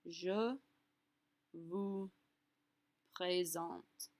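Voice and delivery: each French word isolated by long pauses; one word at a time for repeating; word-by-word demo. Shown as a demonstration. Teacher breaking up the formal phrase for learners, word by word. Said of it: "flip out the te for a vous".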